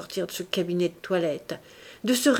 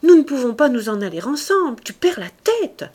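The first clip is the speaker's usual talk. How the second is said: high, loud, hurried protest; protestation affolée; 6 semitones higher, 8 dB louder than usual. A narrator's reading. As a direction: cry out in alarm, pitch climbing. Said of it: "s'écria-t-il". (protestation haute, forte et pressée)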